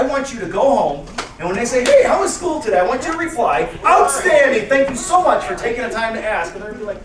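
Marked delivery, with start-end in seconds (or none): 1.8-2.8 high, loud welcome-home question; cheery greeting. Acted. Spouse asking about the day at school, cheery. 3.8-6.5 loud, high, gung-ho answer; enthusiastic reply. Acted. Speaker modelling the answer to give at home, enthusiastic.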